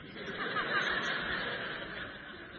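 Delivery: a high airy burst of laughter; laughing at his own joke; high breathy laugh. Real, not acted. Preacher to congregation, laughing after the pastor pay joke.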